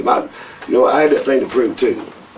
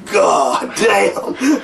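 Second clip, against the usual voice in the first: much higher, drawn-out astonished curse; imitating Arn Anderson's amazed gasp; stunned exclamation; 10 semitones higher than usual. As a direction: let it out in sheer disbelief. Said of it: "I heard Arn Anderson go".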